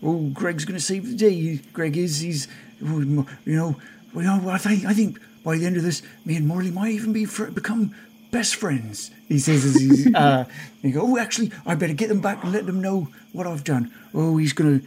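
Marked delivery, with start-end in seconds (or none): 0.0-9.1 higher, soft character voice; softly daydream aloud in a higher voice; eager Greg voice. Acted. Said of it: "you can just hear this, this voice". 11.0-14.8 excited little self-talk, kept soft; eager Greg voice. Acted. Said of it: "this voice".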